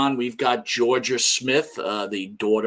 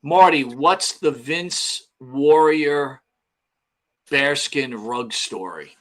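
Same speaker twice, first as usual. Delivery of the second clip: slow reading; 2 semitones higher, slower than usual. Real, not acted. Slowly reading a viewer question.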